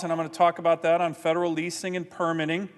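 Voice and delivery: ordinary delivery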